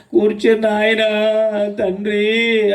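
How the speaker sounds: drawn-out, lightly mimicked wail; mock wailing. Preacher imitates empty weeping before God, mock wailing. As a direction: stretch out the last word like a mock lament. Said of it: "ఏడు అనే పదానికి అర్థం ఏంటనుకుంటున్నారు?".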